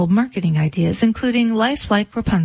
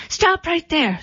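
sharp high scream; 9 semitones higher, slower than usual. A narrator's reading. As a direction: shriek it from the window. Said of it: "screamed a voice from the window".

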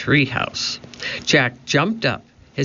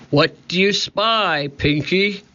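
higher-pitched pirate captain's call; shouting; 5 semitones higher than usual. A narrator's reading. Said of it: "shouted Cap'n Bones".

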